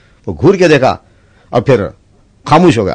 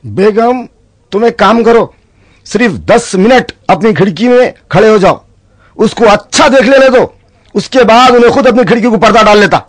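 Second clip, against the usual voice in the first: a touch of husband; 7 semitones higher than usual. Acted. Husband answers wife.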